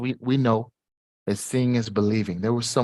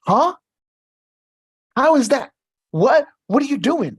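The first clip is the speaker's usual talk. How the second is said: astonished realtor; 7 dB louder than usual. Retold or imitated. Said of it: "He was like".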